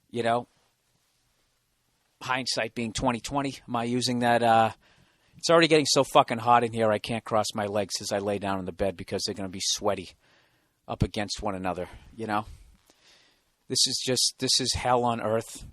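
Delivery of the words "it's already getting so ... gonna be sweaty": ordinary talk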